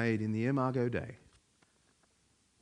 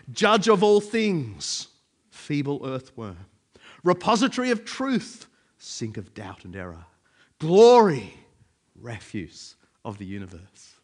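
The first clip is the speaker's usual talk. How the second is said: declaimed quotation; 7 dB louder than usual. Acted. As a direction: proclaim each lofty title, then drop away on the lowly one. Reads a Pascal quotation aloud.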